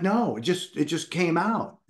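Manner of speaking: high baffled reply; high pitched. Retold or imitated. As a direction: insist you have no idea where it came from. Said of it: "And I'm like".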